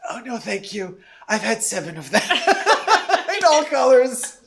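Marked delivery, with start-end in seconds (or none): 0.1-2.2 soft, dry no-thanks; quiet polite refusal. Retold or imitated. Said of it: "Jessie was like". 2.3-3.2 squealing high-pitched giggle; high laugh. Real, not acted. Laughing mid-story.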